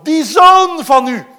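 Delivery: loud slow accusing shout; schreeuwend. Acted. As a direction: spit out the words in anger. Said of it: "En dan schreeuwt hij nog". (luid en traag schreeuwen)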